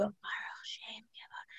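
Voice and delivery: put-on hushed child's whisper; imitating kids whispering the line. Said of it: "and then they go".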